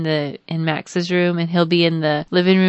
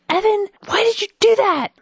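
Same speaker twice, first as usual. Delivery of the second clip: much higher, sharp scolding cry; shocked outburst. Retold or imitated. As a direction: snap it at the boy. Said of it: "I was like".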